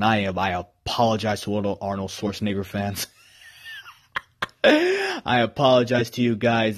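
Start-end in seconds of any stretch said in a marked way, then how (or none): none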